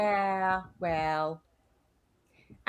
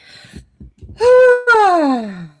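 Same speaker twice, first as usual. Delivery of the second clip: loud, high sigh falling away; exasperated sigh; 13 semitones higher, 14 dB louder than usual. Real, not acted. Hearing of religious communities, a loud exasperated sigh.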